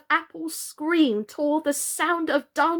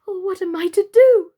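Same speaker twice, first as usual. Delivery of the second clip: higher, louder anguished cry; cried out; 8 semitones higher, 9 dB louder than usual. Acted. Birdie crying out, not knowing what to do. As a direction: cry it out in helpless panic. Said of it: "cried Birdie".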